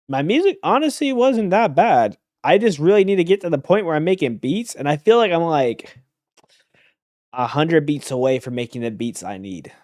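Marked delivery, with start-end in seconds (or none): 0.1-7.9 higher, louder and fast, bursting with enthusiasm; eager, rising excitement; excited. Real, not acted. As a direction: rush it out, fired up about the music. Excited about his music.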